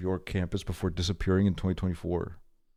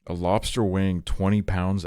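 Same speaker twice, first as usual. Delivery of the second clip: usual speaking voice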